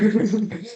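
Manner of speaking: a quick amused laugh, pitched high; short laugh. Real, not acted. Student laughs off the interviewer's teasing question, short laugh. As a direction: laugh off the joke. Joke about crying in hostel.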